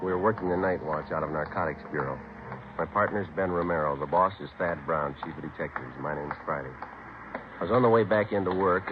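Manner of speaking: terse, flat-toned first-person report; just the facts; clipped detective narration. A narrator's reading. Detective Friday narrating his case, terse.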